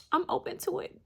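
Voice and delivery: high and teasing; silly. Real, not acted. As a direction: toss it off playfully. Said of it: "I'm being silly".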